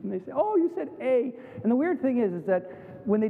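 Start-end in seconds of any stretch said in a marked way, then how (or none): 0.3-1.3 higher-pitched teasing Canadian "eh"; amused imitation of Canadian speech; mock Canadian accent. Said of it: "knew we were Canadians by our accent".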